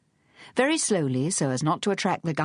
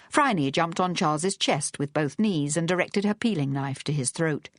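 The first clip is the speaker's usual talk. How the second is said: plain reading voice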